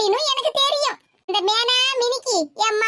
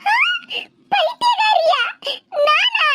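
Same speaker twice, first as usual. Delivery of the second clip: shocked, high and loud retort, half laughing; indignant outburst; 10 semitones higher, 3 dB louder, slower than usual. Acted. Old woman stung at being called a madwoman, indignant cry. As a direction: fire it back, stung and disbelieving.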